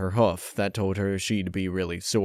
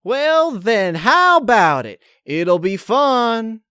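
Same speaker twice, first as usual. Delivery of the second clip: much higher, louder, forced cheer; overdone enthusiasm; 13 semitones higher, 7 dB louder, slower than usual. Acted. Applejack pressing Twilight to come over, forced loud cheer. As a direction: push the enthusiasm too hard. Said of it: "felt like she was overdoing the enthusiasm".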